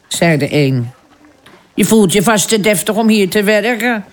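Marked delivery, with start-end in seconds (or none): 1.7-4.0 high, scornful jab; sneering. A narrator's reading. Kitchen maid sneering at the posh newcomer. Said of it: "sneerde een ander".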